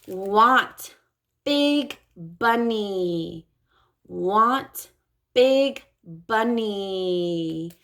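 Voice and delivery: put-on very slow, stretched phrase for a child; slow modeling. Shown as a demonstration. Speech therapist modeling slowly for a child. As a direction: model each word slowly to a toddler. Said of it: "So it might sound like this".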